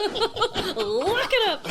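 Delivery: very slow, high, loud echo; drawn-out catchphrase. Pretend. Co-host gleefully echoing the other's catchphrase, drawn-out call. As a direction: stretch it right out, high and playful.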